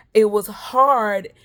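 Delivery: it was hard, slow and loud; drawn-out loud emphasis; emphatic stress. Real, not acted. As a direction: lean hard on every word. Stressing how hard the week was.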